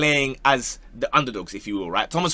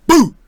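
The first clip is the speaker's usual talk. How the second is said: sudden spooky shout of boo; startling boo; 13 semitones higher, 11 dB louder than usual. Pretend. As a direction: jump out and yell boo. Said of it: "Did I scare you".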